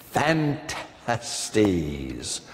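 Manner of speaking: Phantastes said low and drawn out, syllables split; careful title pronunciation. Shown as a demonstration. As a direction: say Phantastes slowly and softly, pausing inside the word. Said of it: "that word is pronounced about twelve different ways".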